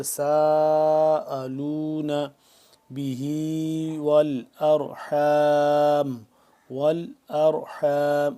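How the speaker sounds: slow, drawn-out chanted tilawat; Quran recitation. Shown as a demonstration. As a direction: recite melodically, stretching every vowel. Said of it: "موٹا کر کے پڑھیں گے".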